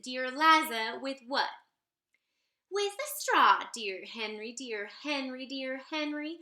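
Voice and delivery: usual speaking voice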